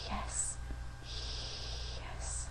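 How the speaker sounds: whispered yes, no voice. Shown as a demonstration. Teacher whispering yes for students to copy. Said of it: "whisper yes again".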